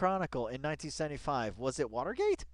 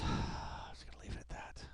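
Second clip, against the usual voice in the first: sighing whispered aside; 10 dB quieter than usual. Real, not acted. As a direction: breathe it out, letting it drop. Sighs, drops the topic.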